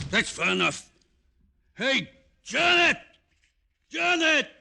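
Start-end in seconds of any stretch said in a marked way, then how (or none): 1.8-4.5 loud, high call across the apartment. Acted. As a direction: shout for her from the door. Calling out to Janet indoors.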